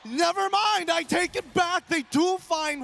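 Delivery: loud, high hyped call; excited. Real, not acted. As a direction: shout it over the crowd noise. Caster reacts to big play.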